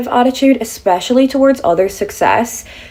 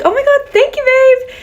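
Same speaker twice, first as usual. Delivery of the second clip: very high-pitched, squealed gratitude; gushing thanks. Retold or imitated. Thanking a random girl for a street compliment, high gushing squeal. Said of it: "And I was like".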